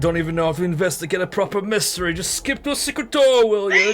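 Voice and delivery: put-on high, loud ranting old man. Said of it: "no more old man voice".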